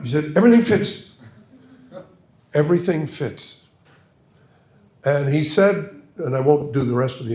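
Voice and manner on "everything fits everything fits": high, louder swallowed English don; Berlin imitation, insistent. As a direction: press the point like the don. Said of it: "I'll try to".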